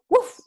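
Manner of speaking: high, short dog yap; dog's bark. Acted. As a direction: yelp it like a little dog. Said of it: "el sonido dice". (ladrido agudo de perro)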